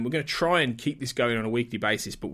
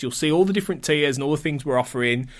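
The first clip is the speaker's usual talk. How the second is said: ordinary talk, nothing marked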